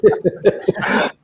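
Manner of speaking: airy high laugh after the impression; breathy laugh. Real, not acted.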